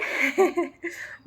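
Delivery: high burst of happy laughter; a sudden high giggle of joy; delighted high laugh. Real, not acted.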